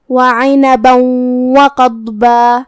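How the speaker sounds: وعنبا merged into وقضبا with a held nasal و; slow chant, humming the doubled و from وعنبا into وقضبا; idgham with ghunna. Shown as a demonstration. Teacher showing idgham, chanting وعنبا وقضبا joined by a hum. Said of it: "تنوین کو واو میں ملا کے غنہ".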